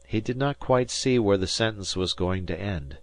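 ordinary delivery, nothing marked

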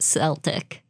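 Celtic starting with an S sound; soft C pronunciation. Shown as a demonstration. Co-host teasing a common mispronunciation, Celtic with a soft C. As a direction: say Celtic as SEL-tik, soft C. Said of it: "at least you don't pronounce it".

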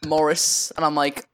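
ordinary delivery

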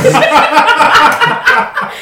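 very high, loud cackling laugh; burst of laughter. Real, not acted. Laughs at the word quest.

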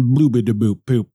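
goofy nonsense syllables; silly nonsense. Pretend. Podcaster warming up with silly nonsense sounds. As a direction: babble like a toddler. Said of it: "I'm not taking a big enough breath".

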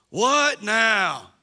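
loud exasperated what now shouted back; a mock teenage outburst; sarcastic kid. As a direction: yell it like a fed-up child. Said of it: "There's a lot of sarcasm".

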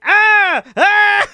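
two high mock screams; hammed-up panicked yelling; freaking-out screams. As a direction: shriek like a man losing it. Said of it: "he just starts freaking out".

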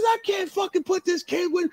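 very high-pitched exasperated yell; retold outraged shouting; going nuts. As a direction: imitate a promoter ranting in disbelief. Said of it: "He's going nuts".

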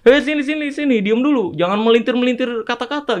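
mock-bossy, ordering them over; retold scolding. Retold scolding, calling him back.

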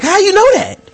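mock shocked, high and laughing; incredulous callout. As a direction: catch him out, cracking up. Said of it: "You're like".

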